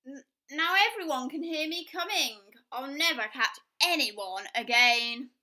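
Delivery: loud, wailing wolf lament; despairing, caught out; crying wolf. Acted. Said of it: "cried the wolf".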